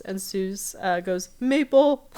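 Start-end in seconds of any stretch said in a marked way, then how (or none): 1.4-2.2 giggled high and loud, laughing through it; high giggly laugh. Retold or imitated. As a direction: burst out laughing on the word. Seuss's silly quote, laughing.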